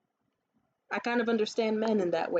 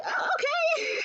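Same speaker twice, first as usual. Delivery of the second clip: very high, startled mock reply; surprised woman; slower than usual. Imitating the woman's startled answer, very high squeak. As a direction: squeak it out, caught off guard. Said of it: "And she was like".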